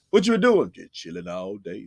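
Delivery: ordinary talk, nothing marked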